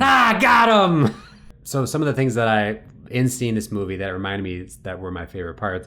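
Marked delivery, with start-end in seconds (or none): 0.0-1.1 high, slow, loud gotcha; triumphant shout. Retold or imitated. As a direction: shout like you caught him. Fan catching the actor.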